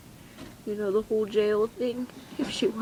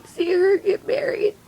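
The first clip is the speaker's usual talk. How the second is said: crying; 7 semitones higher than usual. Real, not acted. Mother imagining her daughter's wedding and grandkids, crying. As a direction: voice climbing high as tears take over.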